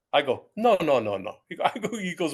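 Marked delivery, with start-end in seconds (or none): 0.6-1.4 loud string of retold no's; loud mock-frantic objection; urgent protest. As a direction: cut in hard to stop the caller. Stops caller from hanging up.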